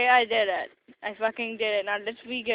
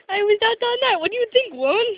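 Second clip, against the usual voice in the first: much higher, louder pretend cat; squeaky cat voice; 10 semitones higher, 6 dB louder than usual. Speaking for the cat to her, high and loud.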